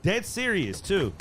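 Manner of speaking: ordinary talk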